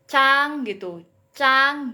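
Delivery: "cang" held high and level; "cang" as a level-tone model syllable; flat first tone. Shown as a demonstration. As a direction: sing out "cang" on one high, even pitch. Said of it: "Kayak datar gitu ya".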